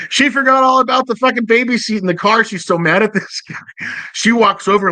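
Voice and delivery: high-pitched, laughing through the telling; incredulous, breaking into a laugh; amused, laughing. Real, not acted. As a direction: crack up while telling it. Laughing at his own story.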